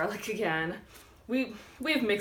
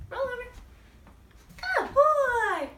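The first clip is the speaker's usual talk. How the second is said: high, loud roll over and praise; high excited dog praise; 11 dB louder, slower than usual. Real, not acted. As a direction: cheer the dog on brightly.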